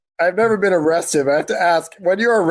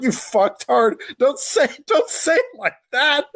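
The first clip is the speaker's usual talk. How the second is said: exasperated scolding; 8 semitones higher, slower than usual. Retold or imitated. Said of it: "And I was like".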